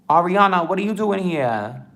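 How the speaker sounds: high, loud delighted surprise; surprised greeting. Real, not acted. Streamer spots Ariana in the chat, loudly surprised. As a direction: exclaim it high and loud at an unexpected arrival.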